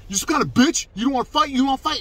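high, loud taunting imitation; imitating loud aggressor. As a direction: mimic him jeering for a fight. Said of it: "this is me imitating him".

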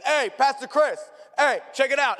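high, loud hail across a hall; excited yell. Retold or imitated. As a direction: call out eagerly to get attention. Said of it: "he yells at me".

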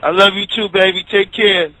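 ordinary delivery

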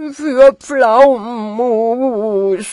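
loud, slow, wavering howl; hammed-up blubbering wail. A narrator's reading. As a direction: bawl it out in long vowels. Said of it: "heulte Peter".

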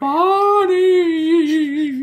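high, long-drawn-out name call; wailing phantom call; eerie calling. Acted. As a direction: stretch the name out like a haunting figment. Imaginary Stalactite calls to Bonnie.

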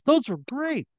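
very high put-on character voice; high-pitched, drawn-out praise; Kevin Heaven. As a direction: pipe up high in a silly voice. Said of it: "And he says".

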